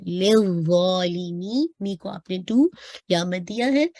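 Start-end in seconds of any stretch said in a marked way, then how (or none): 0.0-1.7 slow "लिज़ालिमी" with a heavy za; recited slowly. Shown as a demonstration. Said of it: "lift up करना है".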